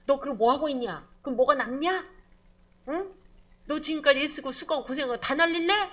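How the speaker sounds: loud, pushy scolding of a depressed man; a scolding counsellor, loud and impatient; harsh rebuke. Acted. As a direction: rebuke him for giving up. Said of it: "이렇게 말한단 말이야".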